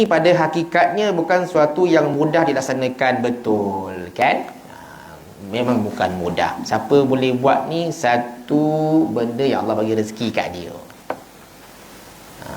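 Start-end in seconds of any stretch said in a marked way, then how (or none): none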